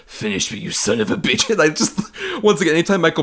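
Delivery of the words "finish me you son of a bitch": mock dying villain. Quoting Paré's mercy-kill line.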